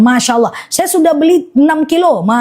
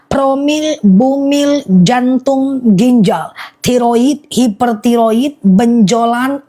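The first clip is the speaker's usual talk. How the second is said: drawn-out list, item by item; slow, deliberate repeat; much slower than usual. Real, not acted. Seller going through the honey's uses for buyers, slowly. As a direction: read each ailment out slowly, pausing between. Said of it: "Aku ulang pelan-pelan ya".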